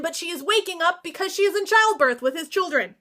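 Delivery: ordinary talk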